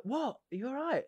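high, soft, worried query; imitated concerned question. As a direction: ask it softly and high, as if overheard. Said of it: "I was like".

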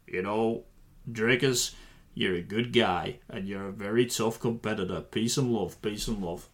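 [name]. Retold or imitated. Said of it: "if Sean was [name], he would say".

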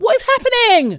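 high, loud cry of disbelief; bewildered outrage. Retold or imitated. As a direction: wail it in exaggerated bafflement. Retelling dismay at TV show.

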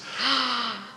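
sharp starstruck intake of breath; excited gasp. Real, not acted. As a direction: gasp in delight. Gasps at [name]'s name.

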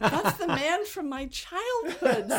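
laughing. Real, not acted. Laughing recollection.

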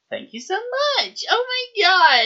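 very high, drawn-out squeal; mock gushing. Actor signing off the recording, mock gushing squeal.

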